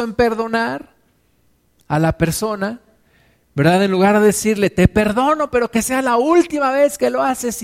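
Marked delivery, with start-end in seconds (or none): 4.7-7.6 higher, pointed and conditional, wagging a finger; reluctant pardon with a stern warning; grudging forgiveness. Pretend. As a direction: forgive them but make the threat heard.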